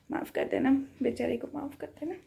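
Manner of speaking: hushed, pitiful please forgive; playful whispered plea; mock whisper. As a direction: whisper a pleading sorry. Mock apology for not leaving.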